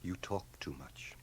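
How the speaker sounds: hushed, tender whisper; intimate whisper. Acted. Ramsay hushes Liesl in whisper. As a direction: whisper it close.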